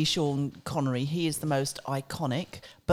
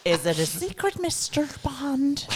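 Bond villain; 7 semitones higher than usual. Pretend. Mock Bond villain line.

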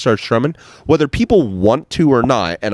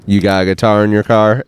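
ordinary talk

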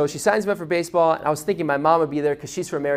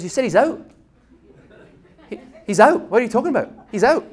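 baffled coach; 5 semitones higher, 5 dB louder, faster than usual. Retold or imitated. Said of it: "He goes".

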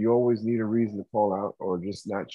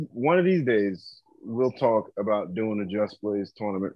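usual speaking voice

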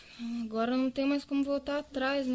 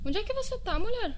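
pitched way up in disbelief; where on earth are you; exasperated high question; 11 semitones higher than usual. Acted.

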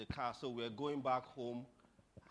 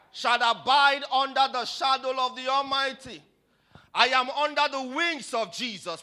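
high, shouted Pentecostal exhortation; impassioned pulpit shouting; full-force preaching; 11 semitones higher, 15 dB louder than usual. Real, not acted. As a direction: preach it at the top of your voice.

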